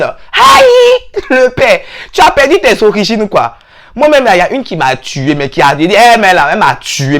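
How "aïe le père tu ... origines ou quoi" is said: mock outrage. Friend mocking someone who frenchified his name Kouman. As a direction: shriek at the fake name.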